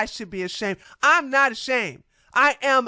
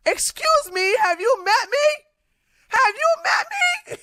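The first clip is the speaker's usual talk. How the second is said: much higher, squeaky have you met me; mock indignation; 14 semitones higher than usual. Answering a commenter in high mock disbelief. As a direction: rise up in disbelief, then laugh.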